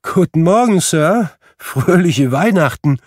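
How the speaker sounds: high, loud merry greeting; bright-spirited high-pitched hail; cheery passers-by. A narrator's reading. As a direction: call out a jolly holiday hello. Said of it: "drei oder vier lustige Leute".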